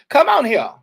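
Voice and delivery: loud high call to come here. Real, not acted. Calling a hiding coworker out from behind the shelves, loud and high. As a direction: shout it across the aisle.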